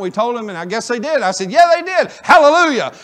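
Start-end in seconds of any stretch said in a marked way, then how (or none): 1.5-2.9 rejoicing shout. Retold or imitated. Rejoicing over answered prayer.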